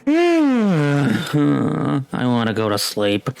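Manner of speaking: long mock groan sinking into a grumble; lightly menacing groan. Game master voicing the sleepy watcher on the mountain, lightly menacing groan. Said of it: "looks through menacing and goes".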